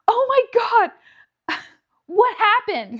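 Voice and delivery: high, loud shocked friend; retold alarm. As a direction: gasp it out like an alarmed best friend. Retelling friend's alarmed FaceTime reaction.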